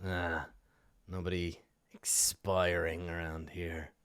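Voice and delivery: low, soft, slow denial; dismissive dog owner. Acted. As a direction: brush them off quietly in a low voice. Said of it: "he shakes his head and he says".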